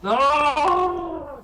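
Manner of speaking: shouted no. Real, not acted. Inspiration used, player protests.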